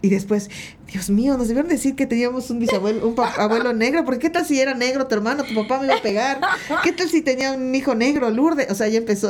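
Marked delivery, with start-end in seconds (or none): 0.9-8.7 higher-pitched, mock-alarmed relative; retold agitated fretting. Relative fretting to Lourdes about a secret grandfather, retold agitated fretting. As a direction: fret aloud in a raised pitch, as if retelling.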